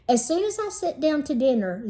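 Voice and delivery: ordinary delivery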